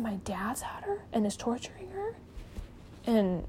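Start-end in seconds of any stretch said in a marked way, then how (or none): 0.0-2.2 hushed, softer horrified whisper; retold whisper. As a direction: drop to a whisper in shock. Daughter's horrified realization.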